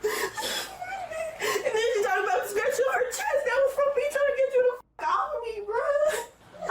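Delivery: real tears, voice high and breaking; words pushed out through sobbing; crying. Real, not acted. Young mother telling how her mother choked her, crying high and breaking.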